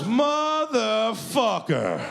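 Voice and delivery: very high, long drawn-out cry; exasperated yell. Retold or imitated. Host learns door is already shut, exasperated yell. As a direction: stretch the curse out in high exasperation.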